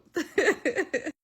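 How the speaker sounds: high, rippling laugh; real giggle. Real, not acted. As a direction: laugh at your own story. Laughs at her own confession.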